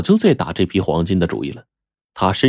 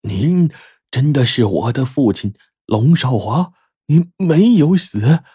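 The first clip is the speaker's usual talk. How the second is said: higher, halting, as if half dreaming; dazed disbelief; 6 semitones higher, slower than usual. A narrator's reading. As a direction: ask haltingly, hardly believing it, slowing at the end. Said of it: "半梦半醒地说".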